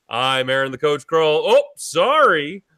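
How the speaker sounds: ordinary talk, nothing marked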